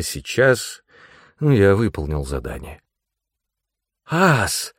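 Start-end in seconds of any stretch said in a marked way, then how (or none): 4.1-4.7 high, startled call; sudden, shocked exclamation; gasped. A narrator's reading. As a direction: gasp the name in alarm. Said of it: "ахнул я".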